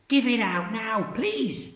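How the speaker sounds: put-on high cackling old woman; old Grandma voice. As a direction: relish the medicine greedily.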